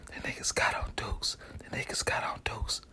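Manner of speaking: hushed secret whisper; whispered warning. Retold or imitated.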